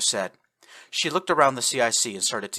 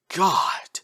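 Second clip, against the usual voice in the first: breathless whispered God; 8 semitones higher, 3 dB louder than usual. Acted. Shocked whisper at captain's death.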